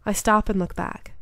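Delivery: usual speaking voice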